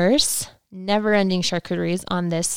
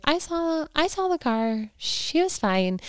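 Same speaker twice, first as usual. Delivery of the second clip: a high, put-on Lisa voice, lightly done; imitating Lisa; 9 semitones higher, slower than usual. Host mimicking Lisa on a talk show, mock high voice. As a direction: toss it off like a bored celebrity, higher. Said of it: "she's like".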